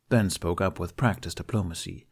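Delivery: ordinary delivery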